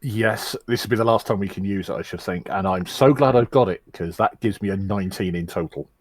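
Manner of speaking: usual speaking voice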